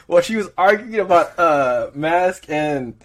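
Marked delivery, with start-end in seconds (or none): 0.1-1.4 exasperated retelling. Real, not acted. Exasperated complaint about the customer.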